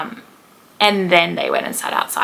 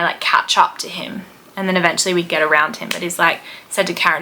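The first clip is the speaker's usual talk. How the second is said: normal talking voice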